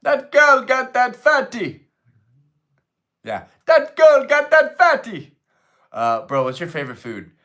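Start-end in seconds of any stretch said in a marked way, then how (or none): 0.0-1.9 very high, loud, drawn-out put-on voice; hammed-up high yell. Repeating the shouted joke line. 3.7-5.4 very high, loud, drawn-out put-on voice; hammed-up high yell. Repeating the shouted joke line.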